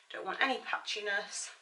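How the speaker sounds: ordinary talk, nothing marked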